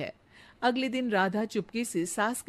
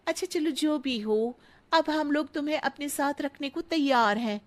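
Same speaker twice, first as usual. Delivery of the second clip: higher, gracious-sounding offer; condescending auntie; 6 semitones higher than usual. Acted. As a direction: grant her a favour.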